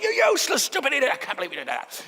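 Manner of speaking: high, scornful berating of yourself; harsh self-criticism. Retold or imitated. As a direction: scold yourself like a mean inner critic. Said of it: "something more along the lines of".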